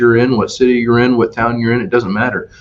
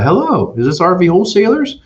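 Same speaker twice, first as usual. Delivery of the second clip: high, sweet phone-caller voice raised well up; 9 semitones higher than usual. Retold or imitated. Retelling a customer's phone call, high caller voice. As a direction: play the woman calling the dealership, pitched high.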